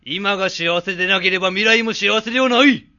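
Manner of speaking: put-on booming declaration from an old sage; loud proclamation. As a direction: proclaim it to the room. Old sage gives grand answer.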